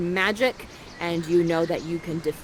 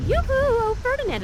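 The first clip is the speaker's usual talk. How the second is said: high, sing-song flirty call; 4 dB louder than usual. Acted. Miranda distracting Ferdinand, in a high flirty call. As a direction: lure him over playfully.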